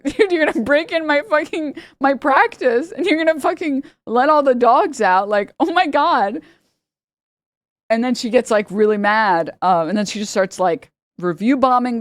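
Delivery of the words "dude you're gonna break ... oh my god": much higher, disbelieving mock rant; ranting about the break-in, exasperated; exasperated vet. Vet fed up with the lady, exasperated mock rant. Vet calling cops on her.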